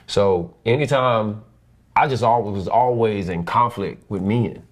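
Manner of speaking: ordinary delivery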